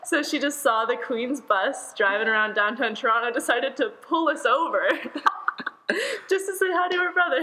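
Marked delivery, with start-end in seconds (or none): 3.3-7.4 high punchline breaking up in laughter; real laughing. Real, not acted. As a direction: laugh through the absurd reveal.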